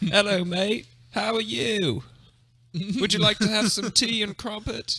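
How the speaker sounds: high, loud stage-British chatter; over-the-top English accent, offering tea; mock British accent. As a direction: camp it up like a tea-party Brit. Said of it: "impersonation of a British person".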